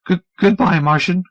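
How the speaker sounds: high, wavering sad farewell. Acted. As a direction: say goodbye sadly, stammering. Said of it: "he said sadly".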